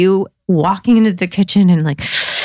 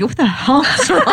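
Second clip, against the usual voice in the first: startled partner; 9 semitones higher than usual. Retold or imitated. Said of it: "and he's like".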